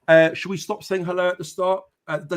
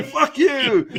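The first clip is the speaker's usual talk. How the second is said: laughing mock Irish fighter's jab, high and loud; a laughing Irish Conor McGregor insult; 10 semitones higher, 5 dB louder than usual. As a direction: spit it out like a cocky Irish brawler, laughing. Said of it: "That's my best Conor McGregor voice".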